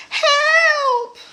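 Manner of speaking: very high, loud cry; shouting for help. Acted. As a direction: scream it for help. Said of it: "he shouted".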